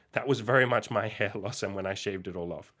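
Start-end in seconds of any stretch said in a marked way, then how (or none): none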